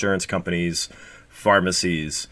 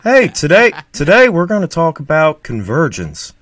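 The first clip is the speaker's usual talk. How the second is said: Steve impression; 6 semitones higher, 5 dB louder than usual. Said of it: "that's my Steve".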